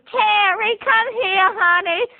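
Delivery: put-on high, sugary old-lady call; grandma calling her grandson over, high and sugary; sweet grandma. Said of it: "she says".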